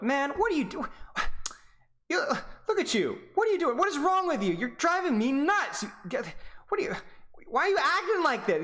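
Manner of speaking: put-on comic outrage at a kid; mock irritated. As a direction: play the fed-up coach for laughs. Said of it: "I'd get irritated at them".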